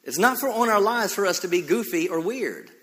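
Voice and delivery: full-force sermon delivery with drawn-out emphasis; fervent preaching. Real, not acted. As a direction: drive it home to the congregation, leaning on key words.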